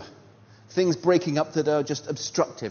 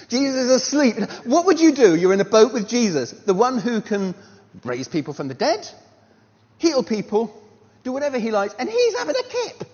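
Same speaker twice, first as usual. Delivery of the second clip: louder, higher, punchy preaching build; forceful preaching; 8 semitones higher, 9 dB louder than usual. Real, not acted. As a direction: drive the point home to the congregation.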